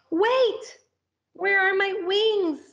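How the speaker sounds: high, loud panic; panicked cry. Acted. Caterpillar falling, realizing it has no wings. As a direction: yelp in sudden alarm.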